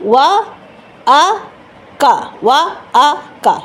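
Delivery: ওয়া, আ, কা each spoken as a separate sound. Shown as a demonstration. Teacher breaking the word into three sounds. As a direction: split the word into ওয়া আ কা, pausing between. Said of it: "কি কি sound?".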